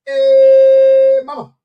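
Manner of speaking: loud, high shout of greeting; big high-pitched hey to mama; calling out to mama. Acted. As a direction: holler it across the room, high and loud.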